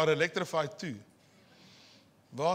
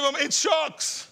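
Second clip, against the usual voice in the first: smug retort; 8 semitones higher, slower than usual. Retold or imitated. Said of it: "And I said".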